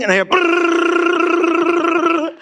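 imitated rumbling engine noise, drawn out; engine rumble. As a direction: make the car rumble with your lips. Car rumble on the road.

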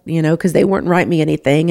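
ordinary talk, nothing marked

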